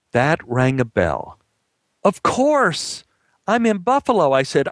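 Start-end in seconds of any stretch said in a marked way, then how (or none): 2.0-4.3 high-pitched excited exclamation; sudden realisation. Acted. Lost actor realises where he is, excited. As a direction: burst out as it all clicks.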